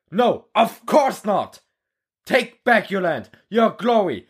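rally the troops, building fervor; a drill instructor firing up recruits; rousing war speech. Acted. Instructor rallying recruits in the hall, rousing speech.

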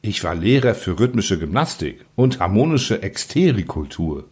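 plain reading voice

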